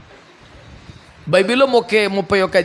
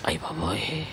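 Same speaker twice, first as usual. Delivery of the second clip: frightened gasp; 10 semitones lower, 14 dB quieter, slower than usual. Retold or imitated. Frightened woman exclaims.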